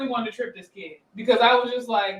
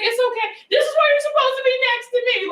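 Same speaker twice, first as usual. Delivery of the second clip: high sing-song mother voice; mom scolding; 14 semitones higher, 4 dB louder than usual. Retold or imitated. Mother telling her fallen child off, high sing-song. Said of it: "She's like".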